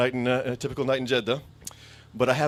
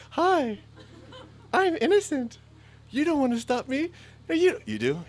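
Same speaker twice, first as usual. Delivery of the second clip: innocent act; 14 semitones higher than usual. Retold or imitated. Playing innocent at the checkpoint.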